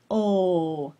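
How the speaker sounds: "Oh" said with tongue tip on the roof; tongue-tip L demo; held L sound. Shown as a demonstration. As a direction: demonstrate the L position.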